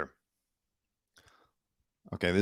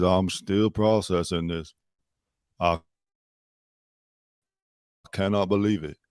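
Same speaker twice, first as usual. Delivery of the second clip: mock Austin Butler [name] accent; 2 semitones lower than usual. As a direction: tease him by drawling it in a faint Southern [name] accent. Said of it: "he wakes up and he says".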